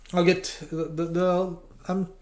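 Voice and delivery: stumbling over repeated words; halting, tongue-tied false starts; stuttering. Real, not acted. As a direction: get stuck on the little words. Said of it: "I'm stuttering".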